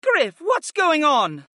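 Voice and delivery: slow, high female voice, bewildered; Viopa chiming in. A narrator's reading. Viopa asks Griff what is going on, slow and high. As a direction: ask your master what's happening, drawn out. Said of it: "Viopa chimed in".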